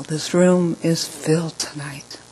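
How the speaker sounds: hushed, slow whisper. Retold or imitated. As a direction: lean in and whisper it. Secret told to her son.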